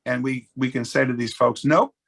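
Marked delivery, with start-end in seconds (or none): 1.7-2.0 louder, higher imitated refusal; mock refusal. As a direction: voice a board turning someone down, pitched up. Board voicing a curt refusal.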